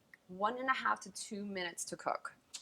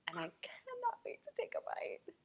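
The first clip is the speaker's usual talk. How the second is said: whisper; 10 dB quieter than usual. Real, not acted. Eager before tasting.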